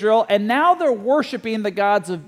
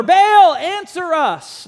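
much higher, louder cry on Baal, dragging answer us slowly; shouting louder; 7 semitones higher, 5 dB louder, much slower than usual. Retold or imitated. Prophets of Baal shouting louder to their silent god, desperate cry. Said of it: "shout all the louder".

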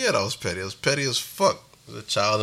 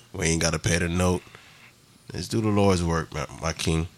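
mock preacher; 6 semitones lower than usual. Mock sermon final thoughts.

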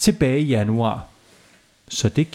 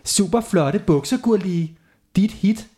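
lightly higher cheerful mom; 6 semitones higher than usual. A narrator's reading. Mother praising the trousers to Gurli. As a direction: chirp it brightly. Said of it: "og så sagde hun".

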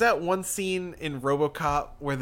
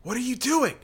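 higher, a bit louder, urgent; urgent commanding priest; 10 semitones higher, 3 dB louder, faster than usual. Acted. The priest urges the guard to open the gate. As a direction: press him to open the gate now.

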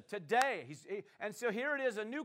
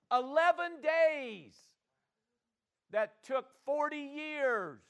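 loud, high, stretched-out preaching; 5 semitones higher, 7 dB louder than usual. Acted. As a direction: hammer each phrase home. Preaching at full force.

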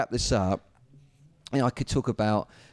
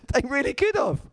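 high, laughing aside; tickled, pitched well up; amused laughing; 11 semitones higher than usual. Real, not acted. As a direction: laugh through the words. Laughing at his own point.

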